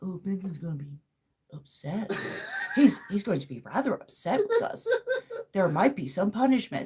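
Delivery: quiet prissy proper voice; overly polite mock-formal; prim cartoon voice. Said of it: "changed it to where now".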